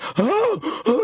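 high, exaggerated gasp; mock frightened gasp. Imitating dad's friend seeing a gun, mock frightened gasp. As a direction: gasp as if spotting a deadly snake. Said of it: "real scared and freaked out".

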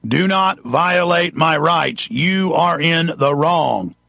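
slow, measured command, word by word; very firm and slow. Shown as a demonstration. Talking to police like dog training, firm and slow. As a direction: state it firmly, spacing out every word. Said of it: "You talk very firmly and very slowly".

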